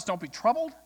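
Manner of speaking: rising, challenging quote; retold disbelief, ending on a rise; incredulous echo. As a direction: throw the comfort back as a question. Protest throws the reassurance back at God.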